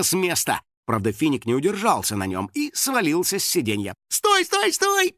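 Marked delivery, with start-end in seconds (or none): 4.1-5.1 very high, panicked squeal; squeaking. A narrator's reading. House spirit falling off the runaway bike, squeaking. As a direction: squeak each word in alarm. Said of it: "пискнул домовой".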